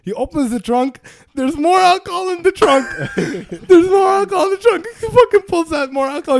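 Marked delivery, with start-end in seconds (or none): 1.3-2.9 excited. Real, not acted. Excited retelling. 3.2-6.4 excited. Real, not acted. Repeated, more excited.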